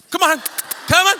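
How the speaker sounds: high, loud hammed-up coaxing; mock coaxing yell; coaxing call to a coyote. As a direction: call the animal over like a pet. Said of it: "My wife is in the street going".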